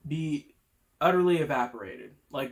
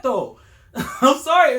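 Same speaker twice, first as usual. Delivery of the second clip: laughing; 7 semitones higher, 9 dB louder, slower than usual. Real, not acted. Laughs mid-sentence.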